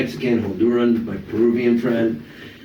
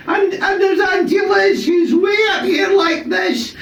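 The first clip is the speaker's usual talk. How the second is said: much higher, slow, shouted Glasgow auntie; Scottish auntie shouting; slower than usual. Retold or imitated. Scottish auntie towering over the boy, in a slow high shout. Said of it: "parents are from Glasgow, Scotland".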